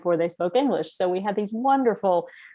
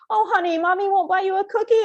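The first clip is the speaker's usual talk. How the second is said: put-on much higher, sing-song sympathy for the toddler; cooing stranger; 11 semitones higher than usual. Stranger leaning over the stroller, higher cooing pity. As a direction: coo down at a crying child in a stroller. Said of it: "a woman leans over her stroller and says".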